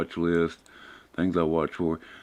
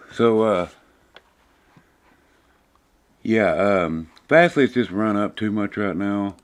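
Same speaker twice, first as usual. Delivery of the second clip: winded, still catching breath; gasping for air; 2 semitones higher, 6 dB louder than usual. Real, not acted. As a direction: talk between heavy breaths. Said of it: "Sorry, I'm out of breath".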